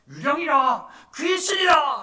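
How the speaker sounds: high, loud cries rising in panic; terrified screaming. Acted. Disciples mistaking Jesus for a ghost, terrified screaming. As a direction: scream it like a frightened crew. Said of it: "막 무서워하면서 막 벌벌벌 떨면서 막 소리 질러대는".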